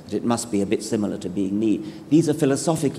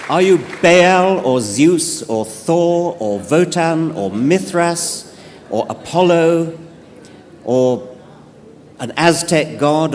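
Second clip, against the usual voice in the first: louder and higher, slow; loud slow listing; 6 semitones higher, 6 dB louder, slower than usual. Pretend. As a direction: call out the names of gods. Listing gods to God.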